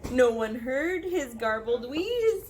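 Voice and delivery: usual speaking voice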